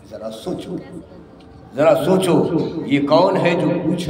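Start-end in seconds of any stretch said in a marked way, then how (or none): 0.1-0.8 hushed. Real, not acted. Repeated, hushed then loud. 1.8-2.4 louder. Real, not acted. Repeated, hushed then loud.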